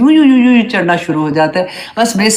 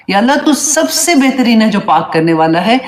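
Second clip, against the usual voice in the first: normal talking voice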